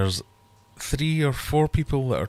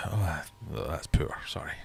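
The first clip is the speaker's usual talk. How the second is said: whispering; 5 semitones lower, 7 dB quieter than usual. Real, not acted. Groaning at a weak rhyme.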